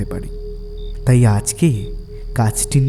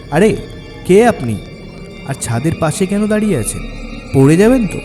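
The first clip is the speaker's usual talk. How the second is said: high, alarmed call to a stranger; 6 semitones higher than usual. Acted. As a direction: call out sharply to someone at the roof's edge. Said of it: "আমি তাকে চেঁচিয়ে বললাম". (চেঁচিয়ে ডাকা, চড়া গলায়)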